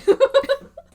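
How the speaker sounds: sudden high shrieking laugh; high squealing laugh. Real, not acted. Friend laughs at the coincidence, squealing high. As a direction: let out a loud squeal of laughter.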